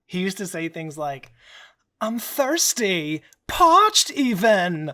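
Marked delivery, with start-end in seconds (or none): 2.0-4.9 very slow, high and loud cartoon lion; Snagglepuss impression. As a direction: drag it out, high and theatrical. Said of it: "my Snagglepuss impression".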